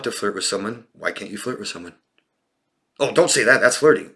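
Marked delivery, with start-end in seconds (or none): 3.0-4.1 louder, higher scolding imitation; mock scolding friend. Therapist mimicking the friend who corrected the flirting, mock scolding. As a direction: mimic a disapproving friend, raised voice.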